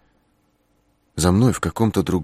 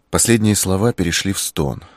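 ordinary delivery, nothing marked

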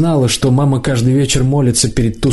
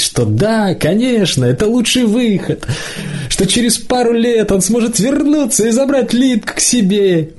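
high, singsong fairy-tale optimism; forced cheer; 8 semitones higher than usual. A narrator's reading. Lidka imagining what she should tell Maksimov, forced cheer. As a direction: pitch it way up, lilting through the happy ending.

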